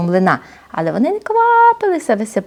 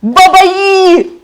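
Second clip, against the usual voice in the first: вигук; 10 semitones higher, 4 dB louder than usual. Acted. Вигук персонажа.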